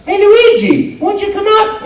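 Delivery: very high, loud coaxing call; Mario calling out. Retold or imitated. Mario calling Luigi for the child, a very high loud call. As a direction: coax your brother out. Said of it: "and they say".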